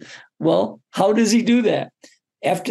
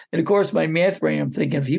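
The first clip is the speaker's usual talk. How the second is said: ordinary talk, nothing marked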